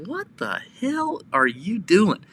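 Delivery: high, slow, drawn-out disbelief; mock indignation. Shoppers confronting cart vigilante, mock indignant. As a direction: challenge him with exaggerated bafflement. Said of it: "these people are like".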